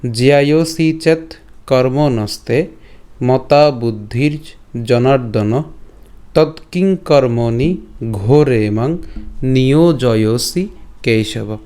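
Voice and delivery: slow, measured Sanskrit recitation, phrase by phrase; careful teaching recitation with pauses between phrases; Sanskrit verse pronunciation. Shown as a demonstration. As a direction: draw out the long vowels as a model for learners. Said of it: "এর উচ্চারণটা কেমন হচ্ছে".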